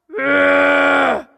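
loud angry ugh; frustrated groan. A narrator's reading. Giant realises tricked.

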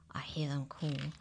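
hushed whisper; whispered aside. A narrator's reading. Narrator whispers to the children between cries. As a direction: drop to a whisper.

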